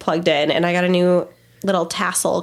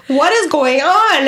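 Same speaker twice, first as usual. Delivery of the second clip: raised, drawn-out exclamation of disbelief; loud, slow retold "what on earth" outcry; baffled outcry; 6 semitones higher, 5 dB louder, slower than usual. Mom retelling the van door opening by itself, baffled outcry.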